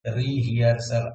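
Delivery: Rehearsal broken into re and hearsal, slow; syllable by syllable. Shown as a demonstration. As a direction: spell out rehearsal slowly by its parts. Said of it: "re plus hearsal". (Rehearsal धीरे धीरे टुकड़ों में)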